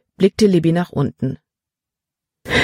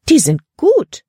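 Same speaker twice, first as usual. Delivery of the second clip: very high, louder outburst; delighted exclamation; 11 semitones higher, 5 dB louder than usual. A narrator's reading. Frau Weaver discovers the wildflower drawings, exclaiming. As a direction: exclaim it in high-pitched admiration. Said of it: "rief sie aus".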